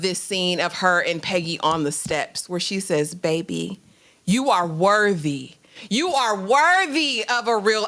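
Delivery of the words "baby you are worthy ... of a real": slow, emphatic motherly reassurance; tender affirmation. Retold or imitated. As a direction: tell her deliberately, weighing each word. Mother reassures heartbroken daughter on steps.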